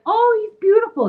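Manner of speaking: put-on high, gushing coo over the baby; gushing friend. Best friend visiting the new mom, gushing high. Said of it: "she's like".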